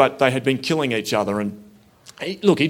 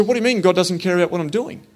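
higher, faster, pressing the question; defensive challenge; 6 semitones higher, faster than usual. Retold or imitated. Walker challenging the priest on his doorstep, defensive and quick. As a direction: push back at him, quicker and higher. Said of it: "I went a bit defensive".

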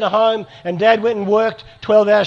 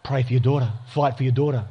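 inner voice of God; 7 semitones lower, 4 dB quieter than usual. Retold or imitated. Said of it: "I hear this voice say".

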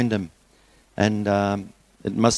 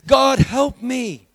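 high, slow, frightened cry to God; desperate plea; much slower than usual. Acted. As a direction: cry out for rescue in terror. Man cries out facing bear.

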